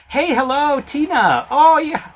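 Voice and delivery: high, bright mock-friendly hello; cheery greeting. Caller greeting agent Tina by name, overly cheery and high.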